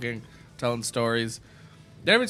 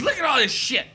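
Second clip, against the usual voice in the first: put-on indignant drunk mom; 9 semitones higher, 3 dB louder than usual. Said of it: "She goes".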